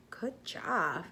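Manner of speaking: usual speaking voice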